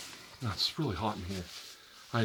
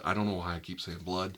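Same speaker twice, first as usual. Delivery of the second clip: normal talking voice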